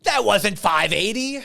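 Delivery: mock yell, pitched high; drawn-out imitation of an irate chef's outburst; Gordon Ramsay yelling. Stoned chef yelling at his smoking buddy, mock outrage. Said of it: "then he's yelling at you".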